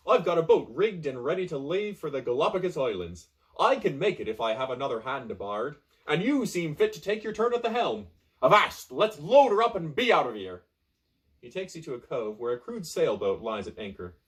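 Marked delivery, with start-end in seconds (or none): 0.1-10.6 louder, higher hearty captain; rally the crew like a jolly pirate; rousing pirate. Acted. Pirate urges the castaway to load the boat, loud and rousing. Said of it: "The pirate smiles and says".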